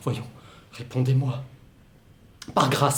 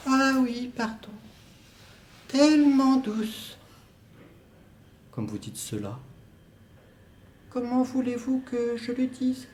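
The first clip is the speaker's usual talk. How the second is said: put-on higher, dreamy young woman's voice; soft high female role; 10 semitones higher, 4 dB quieter than usual. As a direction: play the distant lover, light and airy. Woman's part in two-voice scene.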